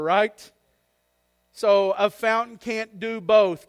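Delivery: fiery preacher; full-force preaching. Acted. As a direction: preach it out to the back row.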